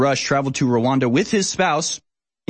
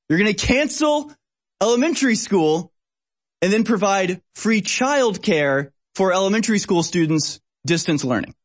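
much higher, stretched outrage on cancel; outraged disbelief; 6 semitones higher than usual. Real, not acted. Host repeating the school plan again, outraged. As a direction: climb higher in sheer disbelief.

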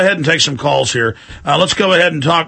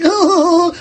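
much higher cooing oh; mock cute anchor; 13 semitones higher than usual. Host mocks cute male anchor, cooing high. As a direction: coo like a charming co-host. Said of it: "little guys going".

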